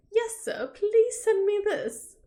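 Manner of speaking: high, posh-ish mock British accent; bad British accent. Wife answering husband in a bad British accent. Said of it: "I do really bad British accents".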